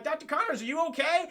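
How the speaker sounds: high-pitched worried call, acted; mock-anxious, pitched well up; concerned calling. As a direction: call out as if checking on someone.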